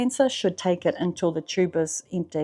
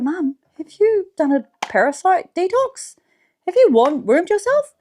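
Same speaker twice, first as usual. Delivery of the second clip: higher, insistent asking of mum; urgent question; 10 semitones higher, 4 dB louder than usual. Retold or imitated. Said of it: "I was like".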